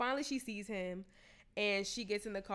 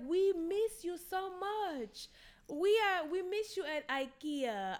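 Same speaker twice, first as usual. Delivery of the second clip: put-on slow, much higher African accent, gushing; 8 semitones higher, slower than usual. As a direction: draw out the words in an African accent. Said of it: "the accent that you're trying to do".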